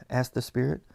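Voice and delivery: plain reading voice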